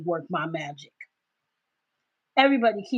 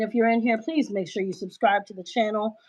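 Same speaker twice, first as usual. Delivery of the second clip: normal talking voice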